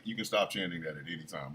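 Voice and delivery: very low, quiet imitation of a wife; hushed deadpan put-down; wife's weary request. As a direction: mutter it dryly, wanting him to stop.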